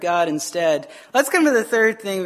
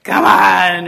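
loud, drawn-out "come on"; played-up loud prodding; mock exasperated urging; 2 semitones lower, 9 dB louder than usual. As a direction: push it out loudly, imitating impatience. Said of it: "and he's like".

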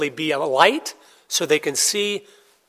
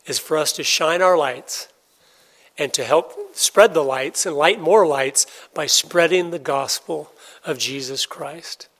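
ordinary talk, nothing marked

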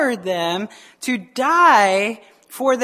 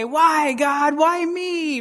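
mock complaint; 5 semitones higher, much slower than usual. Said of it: "shake our fist at the Lord and say".

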